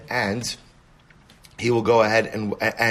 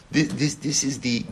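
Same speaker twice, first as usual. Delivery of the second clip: ordinary talk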